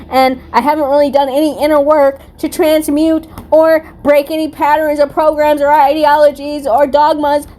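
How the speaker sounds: high, lofty mock narcissist voice. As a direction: play the smug narcissist, pitch up. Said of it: "who's like".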